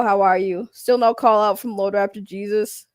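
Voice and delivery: normal talking voice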